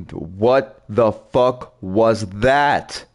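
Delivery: slow, stretched shocked question. Real, not acted. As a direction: drag out the disbelief. Startled by a monster.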